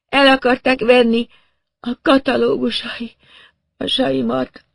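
high, faltering dazed voice; woman in shock. A narrator's reading. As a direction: stumble over the words in a stupor. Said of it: "rendes sokkot kapott odakint".

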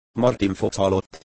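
usual speaking voice

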